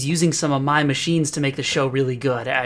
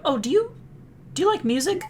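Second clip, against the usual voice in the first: sudden curious question; 13 semitones higher than usual. Acted. Turns back with sudden question.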